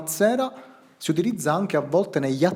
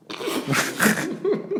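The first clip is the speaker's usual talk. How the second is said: high-pitched burst of laughter. Real, not acted. Laughing at prosopon.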